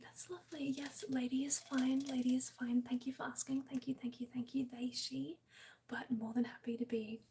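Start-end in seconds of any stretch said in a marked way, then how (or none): none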